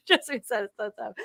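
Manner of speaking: laughing. Real, not acted.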